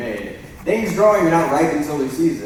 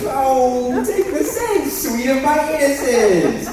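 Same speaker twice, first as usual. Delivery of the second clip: high laughing plea; 12 semitones higher, 5 dB louder, slower than usual. Acted. Lysander pleading, laughing high.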